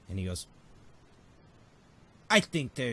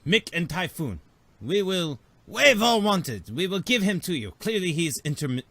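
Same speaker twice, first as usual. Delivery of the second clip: put-on a lightly higher Nemodian accent, bargaining; Nemodian envoy voice; 5 semitones higher, 7 dB louder than usual. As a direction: haggle like a sly alien trader. Nemodian trading with the crew.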